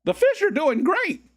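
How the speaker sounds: very high squeaky falsetto; mock voice pop. Streamer mocking his own popped voice about the fish, squeaky. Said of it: "there my voice popped again".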